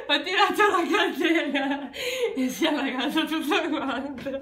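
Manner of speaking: can barely speak through laughter; laughing. Real, not acted. Laughing about flooded toilet.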